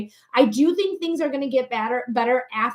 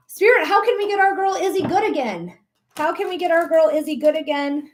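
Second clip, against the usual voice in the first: ordinary talk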